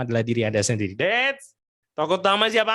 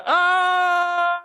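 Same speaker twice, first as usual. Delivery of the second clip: put-on high shrill kid yelp; high-pitched childish "Ah!" scream; child's scream. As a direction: shriek like a playing child. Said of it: "anak-anak tersebut teriak".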